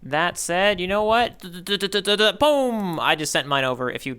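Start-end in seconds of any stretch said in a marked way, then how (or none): 1.4-3.0 high playful drumroll into a boom; a playful fanfare while sending the panel; mock drumroll and boom. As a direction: build a fanfare then hit boom.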